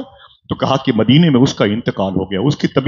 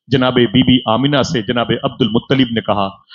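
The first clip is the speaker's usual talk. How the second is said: ordinary talk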